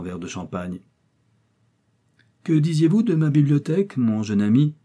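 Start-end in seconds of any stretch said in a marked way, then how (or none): 2.4-4.7 raised, loud, jovial asking; merry old uncle's query; cheerful question. A narrator's reading. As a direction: ask gaily, with good humour. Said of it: "demanda gaiement Mister Thorpe".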